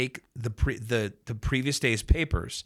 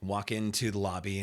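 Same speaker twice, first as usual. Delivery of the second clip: normal talking voice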